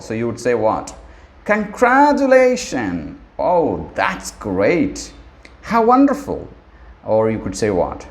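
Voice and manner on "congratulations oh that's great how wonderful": bright high excited praise; rising delighted congratulations. Shown as a demonstration. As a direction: gush over a friend's good news. Teacher demos happy response.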